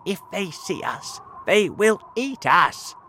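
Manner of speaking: fearful warning. A narrator's reading. Earthworm warns they will be eaten, fearful.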